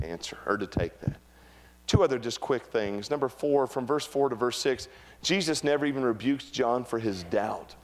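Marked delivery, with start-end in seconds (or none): none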